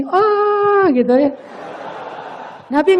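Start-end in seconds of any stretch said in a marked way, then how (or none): 0.0-1.3 high, drawn-out "oh"; acted-out dawning relief, pitched way up; sudden realization. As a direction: imitate a long, high "ohhh" of understanding. Old woman reacts to reassurance.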